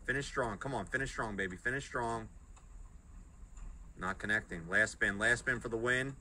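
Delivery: ordinary delivery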